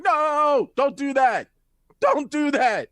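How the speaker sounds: loud, high urgent protest; centaur alarmed. Acted. Centaur begs the kid to stop shaking it, loud high alarm. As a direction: cry out to stop someone in alarm. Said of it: "And she's like".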